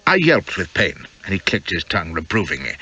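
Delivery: plain reading voice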